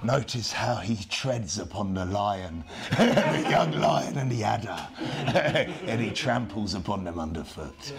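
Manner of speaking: laughing through a nature documentary voice; David Attenborough voice. Retold or imitated. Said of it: "You can imagine him".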